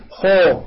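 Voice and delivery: خو modelled long and heavy for repeating; خو as a dark rounded "khaw"; full-mouthed heavy خو; correct heavy kha. Shown as a demonstration. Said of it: "جرب معي".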